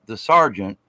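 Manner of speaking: ordinary talk, nothing marked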